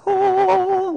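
high, breathy-free "oh" of wonder; a child struck by a wall of toys; awed kid gasp. Retold or imitated. Child in Walmart gasping at the toys, high awe.

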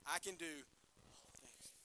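slow, drawn-out opening for the crowd to finish; slow prompting start. Shown as a demonstration. Preacher starting the verse for the congregation, slow and drawn out. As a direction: lead off "I can do all things" slowly, leaving room. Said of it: "So I'll start it, and then you finish it".

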